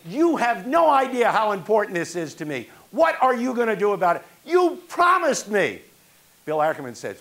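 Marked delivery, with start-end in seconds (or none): 0.1-5.8 retold angry yell, much higher and louder. Young man yelling at the ex-boss on the phone, angry. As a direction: let the hurt boil over at him. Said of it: "I started yelling at him".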